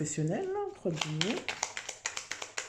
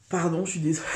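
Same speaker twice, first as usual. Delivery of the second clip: ordinary delivery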